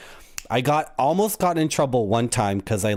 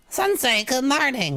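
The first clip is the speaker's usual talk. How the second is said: much higher, sweet student voice; game character voice; 12 semitones higher than usual. Acted. The streamer reads a student greeting her sensei, high and sweet. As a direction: read the character's line like a cheery pupil. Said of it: "so I can't do the voices".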